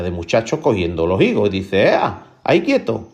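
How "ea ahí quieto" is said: high, sudden halting command; commanding call. Acted. Juan stops boys stealing figs, high acted command. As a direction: call out to freeze them, pitch jumping up.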